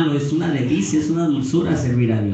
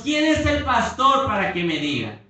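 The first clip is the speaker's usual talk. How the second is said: mock defiant retort; 10 semitones higher than usual. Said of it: "O decimos".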